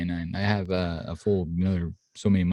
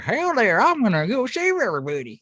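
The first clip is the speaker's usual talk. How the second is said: put-on ridiculous Kansas-Kentucky accent, pitched much higher; mocking Kentucky accent; 12 semitones higher than usual. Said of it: "the accent they made that poor kid from Stranger Things do".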